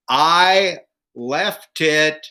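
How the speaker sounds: slow, emphatic decoding with long gaps; deliberate punchline. Acted. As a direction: spell out each mimed clue in turn. Wife decodes her mime, joke punchline.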